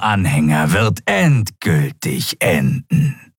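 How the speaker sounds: slow opening, rising into zealous threat; prophesy their doom, drawing out the ends; ominous cult creature's proclamation; reptilian fanatic, menacing. Acted. Cultist threatens his captors.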